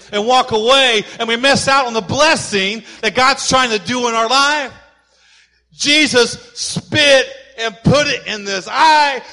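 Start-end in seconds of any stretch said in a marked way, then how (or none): 5.7-9.2 emphatic preaching shout. Real, not acted. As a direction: drive it home to the congregation. Preacher stresses the spit.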